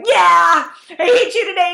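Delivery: playful laughing tease; laughing admission. Retold or imitated. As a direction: confess it with a giggle. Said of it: "I'm like".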